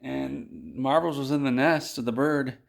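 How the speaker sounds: ordinary talk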